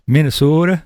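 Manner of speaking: amused take on Minnesota speech; Minnesota accent. Retold or imitated. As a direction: playfully imitate a Minnesotan's long O. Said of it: "they kinda talk like that".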